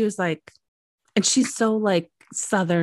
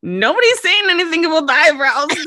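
raised-pitch mock exasperation; retold outburst; 6 semitones higher, 4 dB louder than usual. Friend finally breaks the silence, acted exasperated blurt. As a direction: blurt it out, mildly hammed-up.

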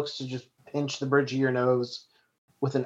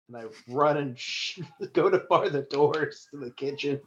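ordinary talk

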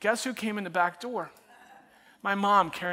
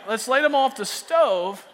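slow, loud, eager pitch of a dumb plan; hammed-up excited teenager; eager teen idea; 6 semitones higher, 7 dB louder, slower than usual. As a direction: sell it like a genius idea. Repeats teen self's idea.

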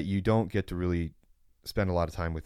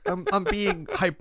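laughing; 12 semitones higher than usual. Real, not acted. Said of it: "being a little bit extreme".

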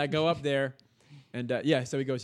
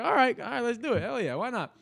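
much higher, fired-up agreement; pumped-up resolve; 11 semitones higher, 3 dB louder than usual. Retold or imitated. Psyching himself up for day two, pumped. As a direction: hype yourself up to go again. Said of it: "I was like".

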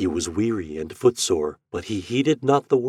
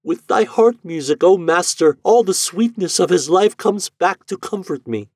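higher, louder voice in rising wonder; Eloise cries out; 10 semitones higher, 6 dB louder than usual. A narrator's reading. Eloise cries out to the master, high and louder. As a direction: cry out as if hearing her dead husband. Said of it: "cried Eloise".

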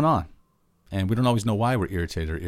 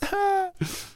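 squeaky high burst of laughter. Real, not acted. Laughing at own outburst.

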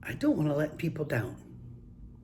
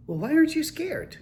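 put-on high, louder child voice, baffled; puzzled grandkid; 5 dB louder than usual. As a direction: imitate a baffled grandchild, pitched up. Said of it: "And they're looking".

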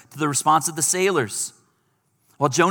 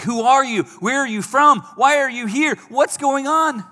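rapid higher, louder barrage of questions; urgent questions; 8 semitones higher, 5 dB louder than usual. Retold or imitated. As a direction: fire the questions at him. Sailors interrogate Jonah.

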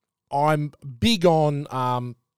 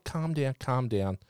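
soft, much quieter soothing words; calming someone down; 4 semitones lower, 7 dB quieter than usual. Retold or imitated. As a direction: hush her gently to calm her down. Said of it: "I'm just like".

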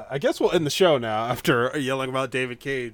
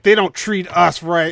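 mock robot complaint; 5 semitones higher, 9 dB louder than usual. Robot character quoted loudly.

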